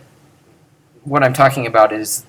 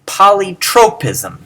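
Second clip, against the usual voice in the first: polytropism with poly light and unstressed; polytropism, poly said lightly; unaccented poly; 7 semitones higher, 4 dB louder than usual. Shown as a demonstration. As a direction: let poly in polytropism springboard on. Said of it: "Notice how the poly is completely unaccented".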